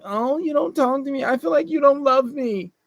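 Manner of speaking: mock girlfriend pleading for attention; mock whiny girlfriend. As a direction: mimic a clingy partner complaining. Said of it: "So when she's like".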